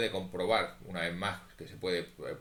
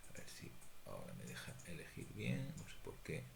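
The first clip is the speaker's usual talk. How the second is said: muttering to self; 12 dB quieter than usual. Real, not acted. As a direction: mumble to yourself while fiddling with the computer. Talks to himself while using the computer. (murmurando para sí)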